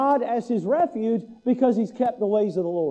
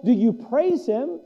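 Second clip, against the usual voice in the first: ordinary talk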